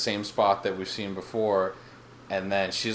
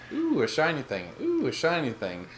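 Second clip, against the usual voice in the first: lightly voiced little kid, higher pitch; mock childlike "ooh" of wonder; fascinated toddler; 6 semitones higher than usual. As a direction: coo it like a distracted tot.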